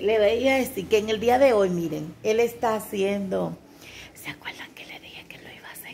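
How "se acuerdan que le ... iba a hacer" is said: hushed whisper to the viewers. Real, not acted. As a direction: whisper it like a secret to the audience. Whispers so filming man won't hear.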